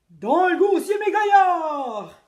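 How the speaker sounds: loud, very slow pirate bellow; pirate chief bellowing at dinner; captain yelling. A narrator's reading. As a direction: pound the table and bellow it.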